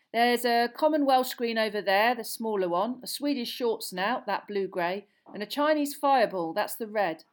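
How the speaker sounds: brisk keeper reporting in; Charlie voice. A narrator's reading.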